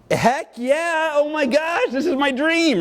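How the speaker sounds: put-on high, bright-eyed teen excitement; thrilled teen. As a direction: squeal it like a fifteen-year-old who got his dream. Teen self thrilled on phone.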